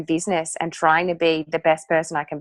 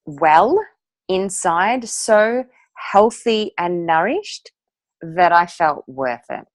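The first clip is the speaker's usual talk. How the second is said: ordinary delivery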